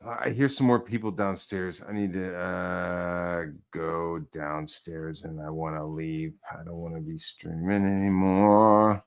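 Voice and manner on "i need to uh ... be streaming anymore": mock weary murmur. Mock-tired aside, low slow.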